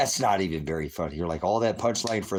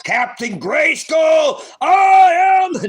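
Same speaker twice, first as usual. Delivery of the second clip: huge slow superhero bellow, high and loud; Captain Grayskull; 13 dB louder, slower than usual. Pretend. Vlogger hamming up a He-Man hero, booming shout. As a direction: roar it like a cartoon muscle hero. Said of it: "I just did Captain Grayskull".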